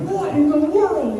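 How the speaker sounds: high, drawn-out disbelief; mock shock. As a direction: play up appalled amazement, stretched and high. Retold shock at what he saw.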